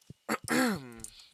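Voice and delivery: a firm throat clear. Real, not acted. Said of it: "Sorry, my voice needed some clearing".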